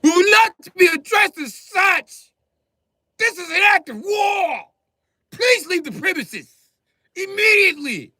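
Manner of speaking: high, loud, haughty proclamation; pompous little lord. Acted. Midget barricaded in his house, lording it over the cops. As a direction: declare it like a tiny king in his castle. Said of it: "like I'm Peter Dinklage".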